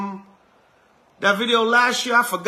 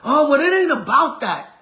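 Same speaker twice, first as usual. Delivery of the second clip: higher, louder brush-off; dismissive relative; 7 semitones higher, 5 dB louder than usual. Retold or imitated. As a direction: wave it away, mock dismissive. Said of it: "Israel ain't trying to hear the history".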